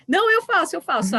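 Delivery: high eager cry of a pupil; squeaky keen kid jumping in; eager student. Retold or imitated. Student volunteering to the teacher, eager high voice.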